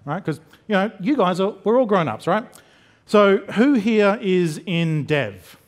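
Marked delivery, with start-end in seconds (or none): 3.1-5.6 slow question, falling away on "dev"; measured, descending roll call; drawn-out audience poll. Pretend. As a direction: ask it slowly, sinking at the end. Audience poll, setting up ops cheer.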